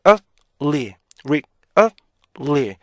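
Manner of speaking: "rick, up, 리" as separate stopped syllables; three-beat breakdown. Shown as a demonstration. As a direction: chant really in three detached pieces. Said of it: "피치가 잘 올라가야 돼요".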